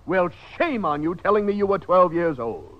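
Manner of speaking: normal talking voice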